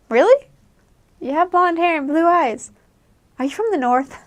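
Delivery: high, loud incredulous question; amazed disbelief. Retold or imitated. Locals doubting a blonde girl is from Chile, loud and amazed. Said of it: "And they're like".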